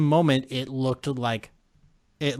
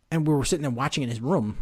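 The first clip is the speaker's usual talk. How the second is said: ordinary delivery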